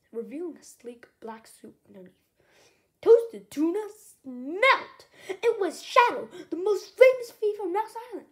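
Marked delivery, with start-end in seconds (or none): 3.0-5.1 slow, loud, stretched-out exclamation; excited exclamation. A narrator's reading. 5.3-8.3 loud, high reveal of the thief; shocked reveal. A narrator's reading.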